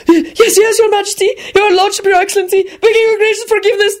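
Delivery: very high, panicked stammering; near howl. A narrator's reading. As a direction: gabble titles in squealing terror. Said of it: "the man practically howled".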